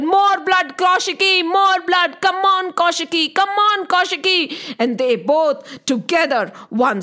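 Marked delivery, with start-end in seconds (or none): 0.0-4.6 higher, urgent calling out; eager shout. Acted. Kal Ratri urging Kaushiki to slay more, higher calling out. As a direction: egg her partner on like a thirsty warrior. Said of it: "she shouted".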